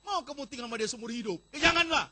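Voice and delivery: loud, high cry of no; loud warning. Acted. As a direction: shout the warning out.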